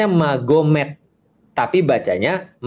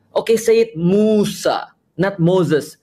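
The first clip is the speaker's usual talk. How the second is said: higher, slow, drawn-out "say it Musa"; mock bossy demand; 4 semitones higher, much slower than usual. Pedant ordering someone to say Musa, mock bossy. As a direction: drag it out like a nagging teacher.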